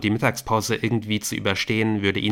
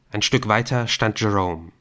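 ordinary delivery, nothing marked

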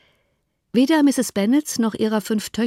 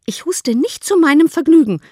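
high, loud, indignant comeback; annoyed retort; 10 semitones higher, 7 dB louder than usual. A narrator's reading. Kitty scolded for her coughing, annoyed retort. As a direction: snap back crossly at a parent. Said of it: "erwiderte Kitty ärgerlich".

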